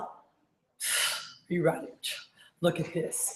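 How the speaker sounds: breathy, hushed build-up; fired-up salesman imitation. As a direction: whisper it slowly like revealing a huge secret. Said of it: "he's still like".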